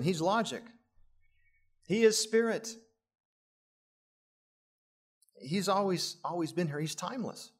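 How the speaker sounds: preaching. Acted. Preacher proclaiming Christ's claims to the congregation, ringing sermon voice. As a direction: proclaim each claim to the room.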